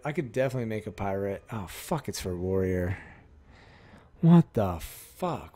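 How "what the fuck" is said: loud, drawn-out exasperation; exasperated disbelief. Real, not acted. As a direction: stretch it out loudly in disbelief. Card is for the wrong class.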